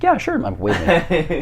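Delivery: put-on high-pitched boyish reply; imitated younger self. Boy accepting a friend's sleepover, high-pitched mimicry. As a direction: agree in a squeaky kid voice.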